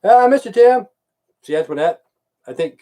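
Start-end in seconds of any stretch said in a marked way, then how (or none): 0.0-0.9 high, louder sugary mock greeting; mock sweetness. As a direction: fake a sickly sweet hello. Mimicking her fake sweetness.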